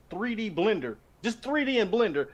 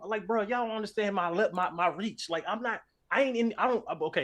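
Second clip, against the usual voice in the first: ordinary delivery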